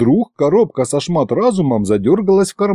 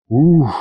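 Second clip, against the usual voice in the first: long breathy exhale of relief; drawn-out sigh; exhaled phew; 3 semitones higher than usual. A narrator's reading. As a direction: let the breath out after a job done. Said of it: "выдохнул я".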